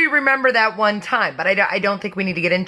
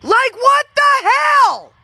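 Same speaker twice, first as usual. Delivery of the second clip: shouted, much higher and slow what the hell; outraged shock; 6 dB louder, much slower than usual. Real, not acted. Outed sister shouting her shock, much higher. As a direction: explode in disbelief.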